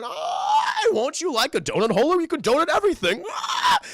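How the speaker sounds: high, loud, swooping monster salesman; abomination creature. Retold or imitated. Creature selling donut holders on TV, abomination voice. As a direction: shriek the sales pitch like a crazed creature. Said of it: "an Ernie Hudson Atticus abomination creature".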